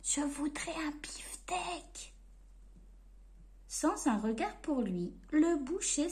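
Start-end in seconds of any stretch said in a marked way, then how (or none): 0.0-2.1 slow, much softer whisper; shy breathy request. Acted. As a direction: ask the butcher in a frightened whisper. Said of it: "répétait Monsieur Silence".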